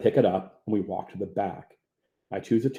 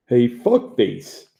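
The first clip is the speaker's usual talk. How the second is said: slow, drawn-out, louder taunt; taunting insult; 5 dB louder, much slower than usual. A narrator's reading. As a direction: drag out the insult to provoke. Bully walks up to insult Hopper.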